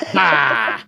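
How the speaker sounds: ordinary talk, nothing marked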